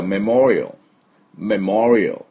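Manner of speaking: メモリアル as "meh-MOR-ee-uhl"; メモリアル in English, swelling on the second syllable; correct English pronunciation. Shown as a demonstration. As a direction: stress "MOR" in メモリアル and end on a dark l. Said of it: "ほとんどもう入れてないよ".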